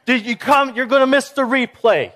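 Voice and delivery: high, loud, laughing call to the other room; excited fan calling out. Retold or imitated. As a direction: yell to your spouse you missed the play. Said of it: "You're like".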